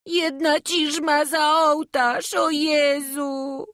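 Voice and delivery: put-on slow, drawn-out boyish lament; acted wailing. Boy laments shoe lost behind altar, wailing. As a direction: wail it out, stretching the words. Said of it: "na górze i beczy".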